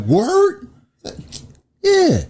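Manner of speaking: high squeaky "word"; thrilled high exchange; excited. Retold or imitated. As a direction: jump way up in delight. Excited disbelief retold.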